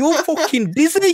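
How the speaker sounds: high, loud, mouthy British geezer rant; British thug Spider-Man. Pretend. British Spider-Man refuses to pay the doctor, loud British rant. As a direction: play a mouthy British lad kicking off at the doctor. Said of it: "Imagine if Spider-Man was British".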